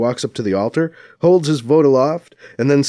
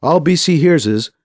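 plain reading voice

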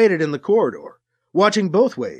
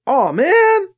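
sigh; 12 semitones higher, 6 dB louder than usual. A narrator's reading. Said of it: "Alibi sighed".

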